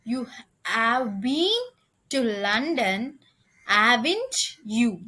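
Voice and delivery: slow, deliberate example sentence; teacher reading an example tag aloud. Shown as a demonstration. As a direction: spell out the tag slowly for the class. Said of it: "Third example".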